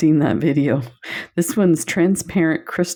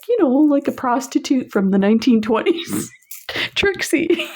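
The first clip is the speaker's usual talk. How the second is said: playful explanation; 10 semitones higher than usual. Retold or imitated. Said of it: "I'm like".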